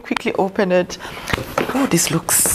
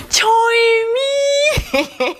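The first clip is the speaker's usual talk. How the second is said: much higher, half laughing, half crying; laughing through tears. Real, not acted. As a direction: giggle and choke up at once, real. Overcome by gifts, laughing and crying.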